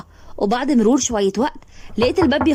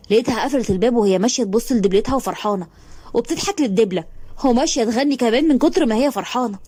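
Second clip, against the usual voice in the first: ordinary delivery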